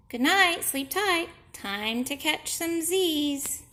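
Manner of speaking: high, loud, slow good night; bright good night. Acted. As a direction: stretch it out cheerfully to the gang. Pete's sleepy good night.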